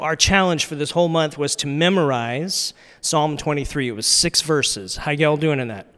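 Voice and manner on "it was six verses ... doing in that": ordinary talk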